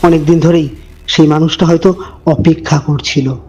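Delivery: low, hushed storyteller voice; eerie narration, measured and dark; horror story narration. A narrator's reading. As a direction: draw the listener into a creepy tale.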